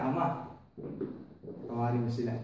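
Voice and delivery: low, soft prayer to the Father; hushed plea. Acted. Jesus praying for his killers, low soft plea. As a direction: say it softly like a dying plea.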